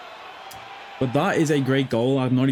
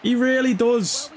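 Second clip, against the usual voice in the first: ecstatic goal shout; 10 semitones higher than usual. Real, not acted. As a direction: belt out the scorer's name and stay elated. Shouting as Carvalho scores.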